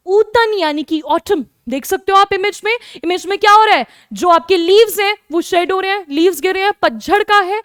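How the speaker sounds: ordinary talk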